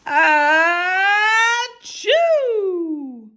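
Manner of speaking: loud high sneeze; big sneeze. Acted. Sneeze acted.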